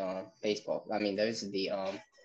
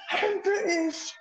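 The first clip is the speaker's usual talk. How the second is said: I'm British drawn out slow and high in a mock British accent; squeaky mock British accent; 14 semitones higher, slower than usual. Repeating the joke, switching to a hammed-up high British accent. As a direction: stretch it out, high and silly British.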